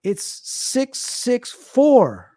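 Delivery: very slow, each number stretched apart; retold slow, deliberate announcement; drawn-out reveal. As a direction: deliver the prankster dad's verdict with long suspense. Joker dad's slow mock-grave reveal.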